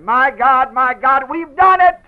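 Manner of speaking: very high, loud, exultant; triumphant cry. Retold or imitated. Jesus cries out in triumph at redemption, exultant shout. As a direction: shout the victory. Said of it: "but he said".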